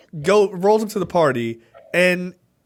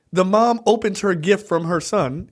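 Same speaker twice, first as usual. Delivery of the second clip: ordinary delivery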